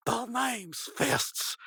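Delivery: slow, high-pitched mock tough guy; tough detective. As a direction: draw out a hammed-up hero's threat. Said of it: "like he's about to deck him".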